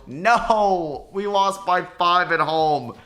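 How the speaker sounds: real groan of dismay, slowed on the loss; crying out at the loss, drawn out; dismayed outcry. Real, not acted. Gamer losing a home playoff game cries out in dismay. His team loses game five.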